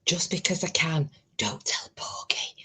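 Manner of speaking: secretive whisper; conspiratorial hush. Real, not acted. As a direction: whisper it like sneaking a treat. Secretly eating chocolate.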